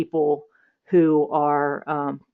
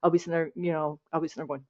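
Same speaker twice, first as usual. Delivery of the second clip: ordinary talk, nothing marked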